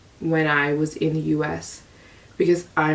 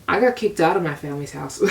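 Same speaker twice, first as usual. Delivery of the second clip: usual speaking voice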